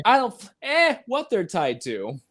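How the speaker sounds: line cut by a high "eh" in place of the swearing; imitating a glitched, censored movie line; audio glitch. Shown as a demonstration. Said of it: "You hear".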